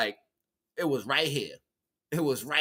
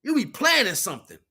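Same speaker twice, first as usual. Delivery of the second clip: ordinary talk, nothing marked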